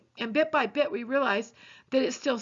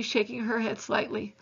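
usual speaking voice

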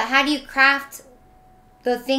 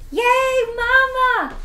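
much higher, happy cheer; excited greeting; 12 semitones higher, much slower than usual. Real, not acted. Daughter cheers her mom in chat, high and excited. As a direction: squeal it up for mom.

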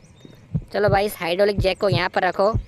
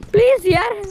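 high, louder pleading cry; desperate plea; 11 semitones higher, 5 dB louder than usual. Real, not acted. Gamer pleading with Granny, high and louder. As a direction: beg with rising desperation. Begging Granny not to catch.

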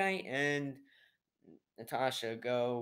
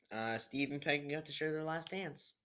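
ordinary talk

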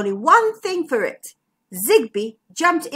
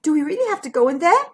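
fast, high, reluctant question; nervous question; 5 semitones higher, much faster than usual. A narrator's reading. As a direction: ask it in a nervous rush. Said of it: "asked Bertie".

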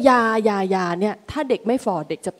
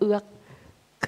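mock gulp; 5 dB quieter, much slower than usual. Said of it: "กลืนน้ำลายเอื้อกๆ".

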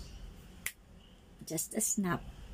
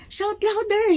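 high, loud jeering call; mocking taunt; 11 semitones higher, 4 dB louder than usual. Elijah taunting the prophets again, high and jeering. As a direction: jeer at them to shout. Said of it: "he said".

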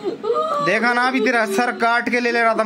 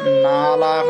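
sudden yell; 14 semitones higher, much slower than usual. Real, not acted. Exorcist scolding jinn.

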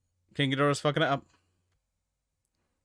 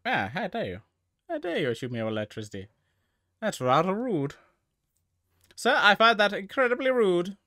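mock prim offense; 6 semitones higher, 3 dB louder than usual. Godzilla scolding the attacker for electricity, mock prim outrage. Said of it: "Godzilla's more just pissed like".

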